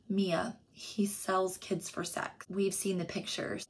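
soft, slow hushed whisper. Acted. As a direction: breathe it gently to a scared girl. Hushed talk with the victim.

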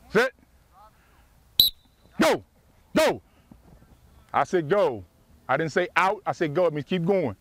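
ordinary talk

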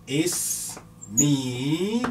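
اسمي drawn out slowly; slow model of اسمي; slow dictation. Shown as a demonstration. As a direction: spell out اسمي slowly for writing.

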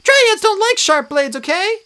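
high, loud squeaky protest; protesting nymph. A narrator's reading. Tree nymph protesting at drawn swords, high squeal. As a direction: squeal it out, indignant. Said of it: "she protested".

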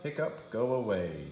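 ordinary delivery, nothing marked

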